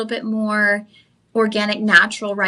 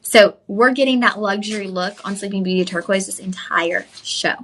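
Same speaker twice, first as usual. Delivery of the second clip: ordinary talk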